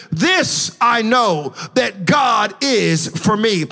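slow emphatic quote. Acted. Quoting the psalmist slowly.

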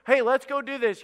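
high, perky encouragement; cheering someone up. Acted. Trying to perk up a mopey person, cheerfully coaxing. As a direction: pitch it up brightly to coax them. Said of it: "You try to cheer that person up".

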